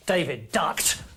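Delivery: ordinary delivery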